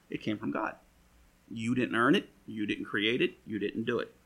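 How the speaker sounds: usual speaking voice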